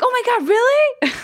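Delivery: high-pitched imitation of shocked friends; mock astonishment. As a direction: squeal it like stunned gossiping friends. Acting out the guys' shocked reaction.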